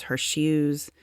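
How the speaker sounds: ordinary delivery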